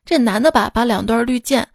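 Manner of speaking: ordinary talk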